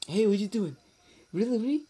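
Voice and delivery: high, loud, eager butting-in; pestering friend. Acted. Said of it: "that friend comes in. They're like".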